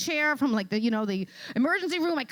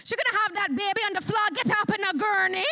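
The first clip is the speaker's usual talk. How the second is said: put-on fast, higher Caribbean island nurse accent; 7 semitones higher, faster than usual. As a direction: rattle it off like a brisk island nurse. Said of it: "she got an accent".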